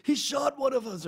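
a retold scream, hammed up; mock screaming. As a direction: scream it to rouse the crowd. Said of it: "starts screaming".

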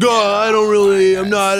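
hammed-up slow, bashful refusal; coy karaoke reluctance. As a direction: feign reluctance to sing. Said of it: "like a slow player on you at karaoke".

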